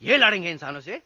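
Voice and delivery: quick, low incredulous question; scornful disbelief. Acted. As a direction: scoff at the very idea, starting low. Scoffing at the monkey army. (अविश्वास भरा तेज़ सवाल)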